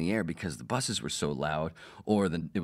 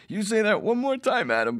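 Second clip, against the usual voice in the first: very high, louder playful mock warning; mock threat through laughter; 7 dB louder than usual. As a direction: threaten jokingly, cracking up. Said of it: "Rick goes".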